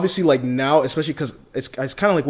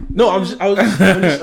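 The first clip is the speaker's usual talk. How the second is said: laughing; 7 semitones higher, 9 dB louder than usual. Real, not acted. Put on the spot, laughing.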